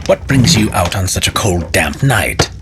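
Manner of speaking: ordinary delivery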